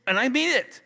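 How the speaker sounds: high, loud "And I mean it"; emphatic insistence. Real, not acted. Preacher insisting his blessing is sincere, emphatic.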